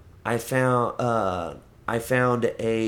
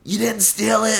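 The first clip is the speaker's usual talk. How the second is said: suspicious question; 12 semitones higher, 5 dB louder than usual. Retold or imitated. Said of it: "She goes".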